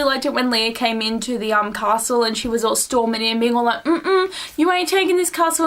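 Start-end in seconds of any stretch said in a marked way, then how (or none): 3.8-5.6 sassy defiance. Retold or imitated. Acting out Leah storming in.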